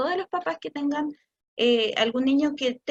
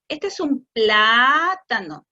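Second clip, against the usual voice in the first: este es un plátano very slow and louder; slow model phrase; 3 semitones lower, 5 dB louder, much slower than usual. Shown as a demonstration. Parent giving child the correct word pattern, slow and louder. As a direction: give the pattern slowly. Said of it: "le damos este patrón".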